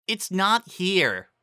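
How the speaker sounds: high and drawn out; high slow voice. A narrator's reading. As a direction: draw it out high.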